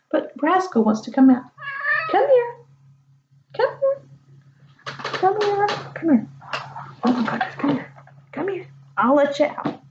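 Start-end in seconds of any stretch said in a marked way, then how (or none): 2.1-6.3 much higher sing-song calling; calling the cat. Real, not acted. Reader calling her cat Rascal, high calling voice. 7.0-9.8 coaxing the cat. Real, not acted. Reader soothing her cat before letting it out, coaxing.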